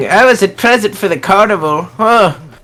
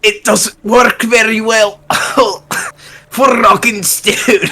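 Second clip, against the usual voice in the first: put-on half-working Scottish accent, pitched up and amused; Scottish old man; 5 semitones higher than usual. Player admits the voice fails, shaky attempt at Scottish accent. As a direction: attempt a Scottish dwarf while laughing. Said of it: "a Scottish accent in an old man voice".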